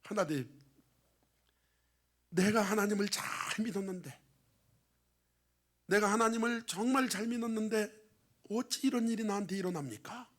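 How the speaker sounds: imitating a grieving man's lament; imitated crying out. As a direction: act out an anguished prayer, restrained. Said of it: "부르짖어 울어요".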